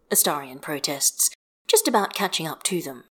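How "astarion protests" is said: ordinary delivery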